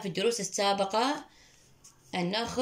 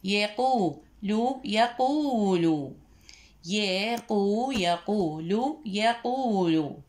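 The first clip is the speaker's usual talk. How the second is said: very slow يقول with each syllable drawn out; slow, careful reading; slow teaching voice; much slower than usual. Shown as a demonstration. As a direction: spell يقول out slowly for the class. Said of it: "كلمة يقول".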